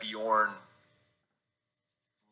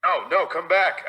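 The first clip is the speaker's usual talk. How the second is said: louder, higher pleading call; calling after someone; 8 semitones higher, 5 dB louder than usual. Acted. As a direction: shout after someone running off. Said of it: "I chase after him".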